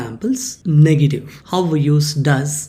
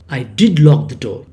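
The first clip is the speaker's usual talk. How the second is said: did hit louder in I did lock the door; stress on did; 3 semitones lower, 5 dB louder than usual. Shown as a demonstration. Teacher repeating the emphatic did, louder. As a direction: insist with a strong did. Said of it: "you are stressing on that".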